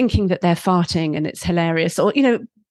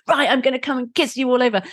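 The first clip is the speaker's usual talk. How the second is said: playful kissing monster; 5 semitones higher, faster than usual. Retold or imitated. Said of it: "I've become the kissing monster".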